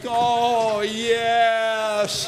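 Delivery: loud, high joyful cry; pure bliss; ecstatic. Pretend. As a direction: grandpa rolling on the ground with grandkids, ecstatic. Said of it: "it's like".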